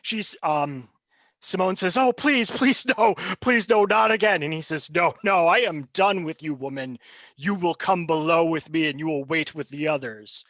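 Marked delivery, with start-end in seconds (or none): 1.9-4.4 high, louder desperate begging; terrified pleading. Acted. Simone pleads with captor. 4.9-10.4 cold, contemptuous captor; dismissive villain. Acted. GM voices the villain figure.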